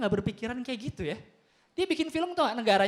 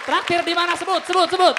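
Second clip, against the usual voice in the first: higher and louder, greedy excitement; excited pushy yell; eager shout; 6 semitones higher, 8 dB louder than usual. Acted. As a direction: demand it loudly, hungry and eager. Heroes eagerly shout for a treat.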